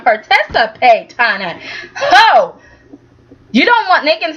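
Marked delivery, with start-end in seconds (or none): 1.9-2.6 high whooping laugh of disbelief. Real, not acted. Reacting to Adam, a high laughing whoop. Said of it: "amused outburst".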